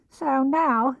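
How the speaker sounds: high, small worried piglet voice; a tiny animal fretting alone in the rain; timid high piglet; anxious Piglet. A narrator's reading. Piglet voiced in read story.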